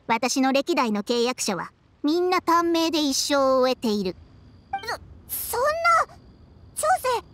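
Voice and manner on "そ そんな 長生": stammering start, then higher and fast; stunned disbelief. Acted. Friend learning contractors die young, stammering shock. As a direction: blurt it out in shock.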